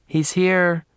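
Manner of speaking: high-pitched announcement to someone behind; said over shoulder. A narrator's reading. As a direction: toss it back over your shoulder. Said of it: "she said over her shoulder".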